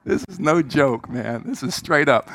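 normal talking voice

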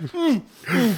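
ordinary delivery

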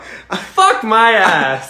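high, loud, very slow curse through laughter; laughing mock curse. Player retelling his strikeout curse, laughing. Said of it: "I'm like".

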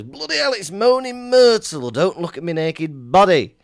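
hammed-up startled outburst; startled Harry. Brother retelling Harry caught in the bath, mock startled. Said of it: "And he goes".

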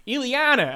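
Ileana said all wrong, pitched high; mock a bad-accent Ileana; mispronounced name. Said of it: "you said that all wrong".

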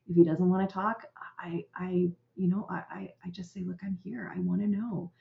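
soft near-whisper; hushed. Real, not acted. Parent inviting a silent child to talk, hushed. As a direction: drop the voice right down. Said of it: "drops to near-whisper".

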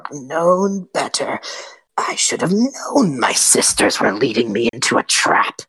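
slow unvoiced hissing hag whisper. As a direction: creep the words out like a cornered witch.

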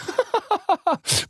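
high, quick burst of laughter. Real, not acted. Host cracks up at his own botched impression, real laughter.

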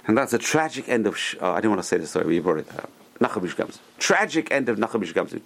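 ordinary talk

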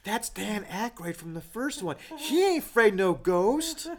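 high, loud, pestering cinema fan; annoying know-it-all fan. Retold or imitated. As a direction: nudge your neighbour, hammed up. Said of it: "The whispering's in the theater".

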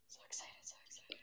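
whispering. Real, not acted. Whispering to herself.

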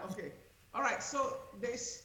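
far away sound. Real, not acted. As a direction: voice faint and distant. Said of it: "Now you sound really far away".

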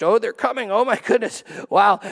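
playful mock excitement; excited watching. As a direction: gasp along as they watch the driver come. Watching the ice cream driver arrive.

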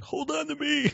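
high-pitched dramatic plea; movie hero line. Retold or imitated. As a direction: ham up the hero's desperate plea. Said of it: "and he's like".

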